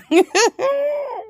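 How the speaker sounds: squealing laugh, high and breathy; high burst of laughter. Real, not acted. Laughing at peeing in the cup again, squealing laugh. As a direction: break into a high helpless laugh.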